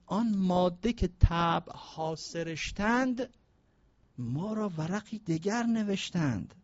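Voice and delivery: very slow, deliberate recital; slow dictation of verse. Acted. Preacher dictating the verse so the audience can write it down, very slow. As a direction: spell the verse out for note-takers.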